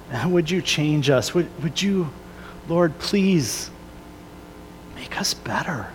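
soft, hushed prayer spoken to God. Acted. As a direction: pray it quietly, pleading and humble. Prays aloud to God.

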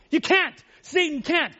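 ordinary talk

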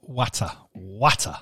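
a light North Lincolnshire 'watter' with short flat a; North Lincolnshire watter. Retold or imitated. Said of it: "as they say in North Lincolnshire".

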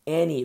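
"any" punched loud; hardcore emphasis on "any". Shown as a demonstration. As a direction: hit the word "any" hard. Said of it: "There's hardcore emphasis on any".

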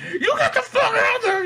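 very high squawked order; hammed-up screechy command; imitating a manic boss. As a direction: squeal it like a frantic taskmaster. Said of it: "I like how this guy's like".

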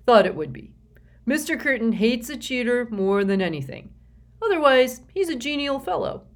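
a genial, slightly dim executive giving advice; a confident company man passing on tips; S Q's voice. Acted. S Q speaks to Sticky.

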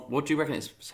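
normal talking voice

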